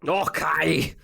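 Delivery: Scottish accent. Shown as a demonstration. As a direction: joke Scottish accent on one drawn-out word. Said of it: "give us your best, uh, Scottish accent".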